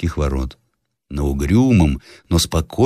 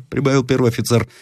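ordinary delivery

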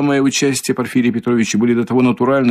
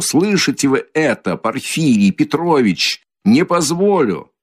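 mild shout; 6 semitones higher than usual. A narrator's reading. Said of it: "крикнул он вдруг изо всей силы".

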